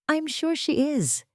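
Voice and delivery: high, slow mocking drawl of a rival; slow sarcastic "I'm sure she is"; sly sarcasm. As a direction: draw it out, dripping with doubt.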